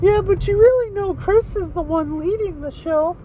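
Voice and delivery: put-on squeaky, very high falsetto girlfriend voice; high-pitched comic girlfriend; Aaron Rodgers' girlfriend. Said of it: "my name's Aaron Rodgers' girlfriend".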